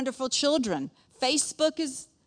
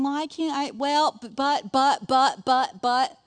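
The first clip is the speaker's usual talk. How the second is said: loud, higher string of objections; stammering protest; 4 semitones higher, 8 dB louder, slower than usual. Retold or imitated. Moses making excuses to God, stammering protest. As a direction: sputter excuses, louder as they go.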